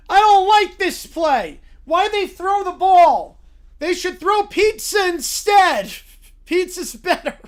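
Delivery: loud, high, drawn-out complaining as Caillou; Caillou tantrum. Acted. Caillou complains about the football game, high whining tantrum. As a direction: protest loudly like an upset cartoon kid. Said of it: "I'm with Caillou on this one".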